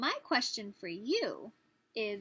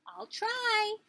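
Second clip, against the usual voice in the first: high, louder tiny bug voice; inchworm; 12 semitones higher, 5 dB louder than usual. Acted. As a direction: pipe up bravely. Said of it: "he said".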